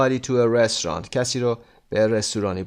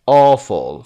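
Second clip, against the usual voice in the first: awful with a strong AW; stress on first syllable; 8 dB louder than usual. Shown as a demonstration. Teacher repeating the word for learners, first-syllable stress on awful. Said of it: "first syllable هست".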